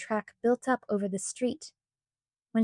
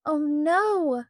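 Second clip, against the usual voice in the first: wailed; 5 semitones higher, 3 dB louder than usual. A narrator's reading. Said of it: "wailed all three girls".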